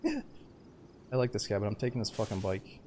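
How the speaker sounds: normal talking voice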